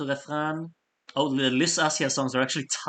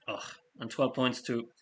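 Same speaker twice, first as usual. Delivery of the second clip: ordinary delivery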